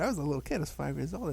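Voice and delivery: ordinary delivery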